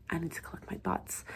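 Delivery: whispered thought; inner whisper. A narrator's reading. Doctor shaken after the scene, whispering. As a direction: say it to yourself, shaken.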